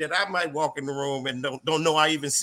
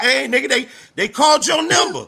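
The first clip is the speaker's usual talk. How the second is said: laughing mock yell, much higher and louder; mock shout; 9 semitones higher, 8 dB louder than usual. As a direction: holler the number like a bailiff. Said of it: "looking at him like".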